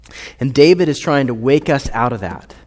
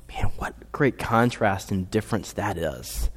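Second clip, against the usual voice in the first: hushed, breathy awe; a hushed aside of amazement; whisper; 4 semitones lower, 6 dB quieter than usual. Real, not acted. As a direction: whisper it in wonder. Awed aside.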